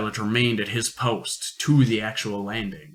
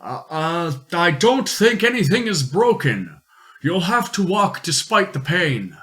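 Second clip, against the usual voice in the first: higher, urgent explorer voice; 5 semitones higher than usual. Acted. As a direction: press him on, firm and urgent.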